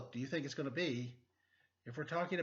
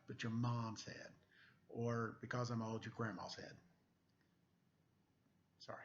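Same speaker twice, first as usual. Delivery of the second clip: plain reading voice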